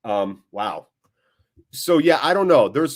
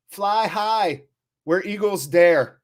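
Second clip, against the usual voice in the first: lifted, proud call of the motto; rallying club motto; 6 semitones higher, slower than usual. Shown as a demonstration. Fan streamer rallies the Rovers viewers, proud motto call. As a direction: toss out where eagles dare like a chant. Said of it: "Where Eagles Dare, which is an old World War Two movie".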